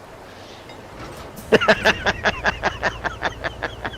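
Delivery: high airy laugh; breathy laughter. Real, not acted. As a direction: burst out laughing. Laughs at the truck.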